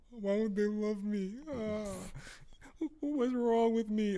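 high, slow mock whine; mopey whining. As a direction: whimper it like a self-pitying mope. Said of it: "I'm not gonna be mopey".

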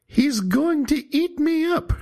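very high, terrified grandma cry; frightened cry. A narrator's reading. Grandma cries out in terror as the wolf arrives, very high frightened cry. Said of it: "she cried".

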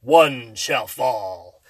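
slow, low heroic robot leader; low, very slow solemn hero line; Optimus Prime voice. Retold or imitated. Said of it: "he says the coolest stuff".

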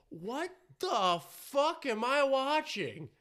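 slow, high incredulous outburst; exaggerated disbelief. Host reliving his reaction to the show, drawn-out disbelief. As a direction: stretch each word in baffled mock outrage.

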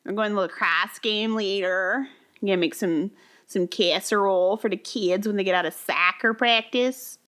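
jokey Minnesota mom, vowels laid on thick; Minnesota accent. Shown as a demonstration. Co-host playing a casserole-making soccer parent, Minnesota accent. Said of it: "my Minnesota accent".

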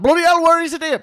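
mock exaggerated Sam. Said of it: "Sam was like".

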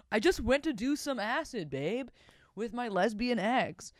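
high, sing-song mock excuse; mocking boyfriend. Host parrots the cheating boyfriend's excuse, high and mocking.

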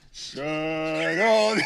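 song collapsing into laughter; tickled mid-note; being tickled. Real, not acted. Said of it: "Last time you just tickled me".

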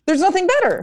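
high, bursting with delight; thrilled, voice jumping up high; excited. Real, not acted. Comedian gushing about a big crowd laughing, excited and high.

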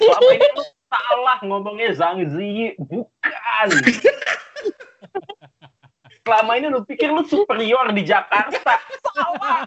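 high-pitched, theatrical telling-off; exasperated rant, climbing to a squeal; mock scolding. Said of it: "gua langsung tuh kayak".

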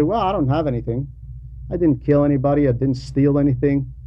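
ordinary delivery

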